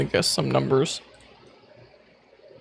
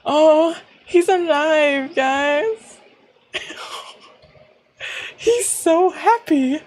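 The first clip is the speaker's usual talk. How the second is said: high, slow and drawn out with joy; delighted squeal; 9 semitones higher, much slower than usual. Real, not acted. Player gushing to viewers about the character, high and slow. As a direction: gush over him being alive.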